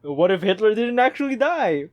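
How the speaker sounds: hammed-up conspiracy teaser; mock documentary drama. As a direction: hype it like a trashy history show. Mocking History Channel show's dramatic question.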